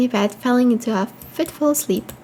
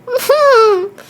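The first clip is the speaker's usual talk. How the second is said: high, loud squealing laugh; shrieking laugh; 10 semitones higher, 5 dB louder than usual. Real, not acted. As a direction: burst into a shrill-edged squeal of laughter. Reacts to feeding the mouse.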